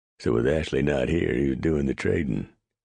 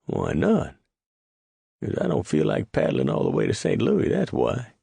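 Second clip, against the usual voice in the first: indignant retort; 13 semitones higher than usual. Acted. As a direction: throw the words back at him.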